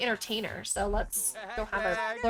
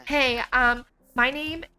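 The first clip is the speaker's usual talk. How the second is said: hiccups; 2 semitones higher, 6 dB louder, slower than usual. Real, not acted. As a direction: voice jolted by hiccups. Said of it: "I had the hiccups for a second".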